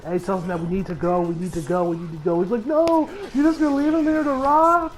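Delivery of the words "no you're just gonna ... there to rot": high, pleading imitation of grief; distraught protest. Retelling a friend refusing to leave the body, high distraught plea. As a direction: protest in a high, upset character voice. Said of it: "he's just losing it".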